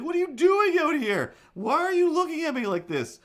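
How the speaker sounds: put-on high, loud affronted old folks; indignant old people. Mimicking old people glaring at her mask, indignant. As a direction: huff like an offended pensioner. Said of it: "who were just like".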